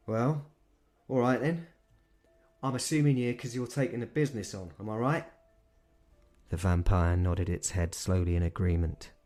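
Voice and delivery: put-on weary old boss talking retirement; London manager. Manager chatting to the vampire, easy London accent. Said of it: "He had a London accent".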